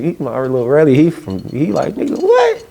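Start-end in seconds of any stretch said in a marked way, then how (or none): 1.9-2.7 squeaky, much higher mock disbelief; incredulous. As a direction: jump way up in disbelief. Friend shocked at question.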